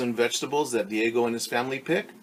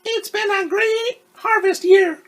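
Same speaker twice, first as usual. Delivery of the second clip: much higher, louder radio host voice; radio host signing off the season; radio woman; 11 dB louder, slower than usual. Acted. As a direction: announce it like a cheerful DJ. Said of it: "the woman on the radio said".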